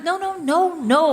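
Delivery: high, repeated mock refusal; exasperated parental objection; emphatic correction. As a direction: shut the idea down with a string of noes. Retold mother correcting her kids.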